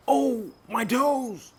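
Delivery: high, loud pained howl, slowed; loud, high, stretched howl of pain; furious howl. Acted. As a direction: bellow it as if you hurt your toes. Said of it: "Bear howled furious".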